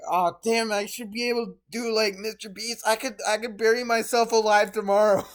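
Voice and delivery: over-the-top excited fan voice; hyped excited viewer. Retold or imitated. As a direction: gush like an inspired fan. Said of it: "And it's like".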